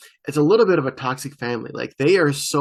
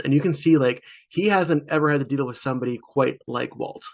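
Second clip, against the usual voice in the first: usual speaking voice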